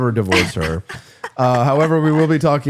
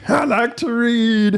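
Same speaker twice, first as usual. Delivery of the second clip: put-on higher, slow, childlike; Simple Jack voice; 10 semitones higher, much slower than usual. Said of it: "I'm like that guy from Tropic Thunder".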